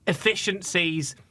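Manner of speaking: ordinary talk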